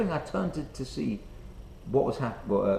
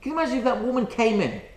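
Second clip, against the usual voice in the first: normal talking voice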